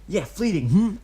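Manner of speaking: put-on high, eager kid voice; young boy voice. A narrator's reading. Kid calling out to Obito, high boyish voice. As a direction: play the boastful kid.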